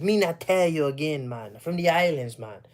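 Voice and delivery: playful imitated island patois; Jamaican accent. Showing off island speech he loves, mock Jamaican accent. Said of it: "I love that accent".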